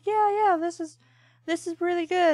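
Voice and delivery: high, eager "yeah, yeah, this is really good"; excited self quote. Retold or imitated. Telling a friend how he got into the cartoon, eagerly high. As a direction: pitch it up like a kid hooked on the show. Excitedly quoting himself watching.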